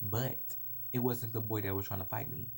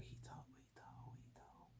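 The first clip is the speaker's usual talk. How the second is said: hushed gossipy whispering; very soft, voiceless repeated whisper; accusing whisper; 22 dB quieter than usual. Retold or imitated. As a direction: whisper it like classmates spreading word. Classmates' accusing whispers acted out.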